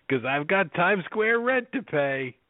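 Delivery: high, drawn-out showman voice; hammed-up restaurant huckster; Guy Fieri. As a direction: stretch it out like a hyped food host. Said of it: "Guy Fieri's thirty dollar Burgers".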